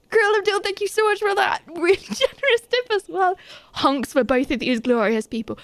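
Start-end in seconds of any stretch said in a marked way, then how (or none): 0.1-3.4 high, gushing thank-you; excited thanks. Real, not acted. Streamer thanking a viewer for a tip, high and excited. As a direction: gush it in a high voice.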